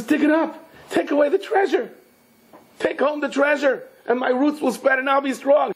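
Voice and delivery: very high, excited urging; urgent pleading tree. Acted. Tree begs the man to dig up the treasure, urgent and high.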